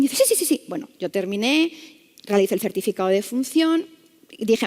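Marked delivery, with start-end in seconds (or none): 0.2-0.6 high, anxious string of yeses; anxious insistence. Retold or imitated. Nurse answers "are you okay?", anxious insistence. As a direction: imitate a rattled nurse brushing off concern. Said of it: "con un ataque como de ansiedad".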